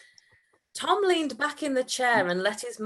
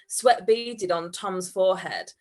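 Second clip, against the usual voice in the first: usual speaking voice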